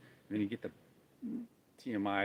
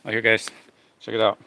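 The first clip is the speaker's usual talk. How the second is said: loud call to the viewers; 12 dB louder than usual. Real, not acted. Calling viewers to look in a loud call. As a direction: grab their attention loudly.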